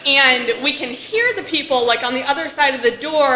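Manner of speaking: ordinary talk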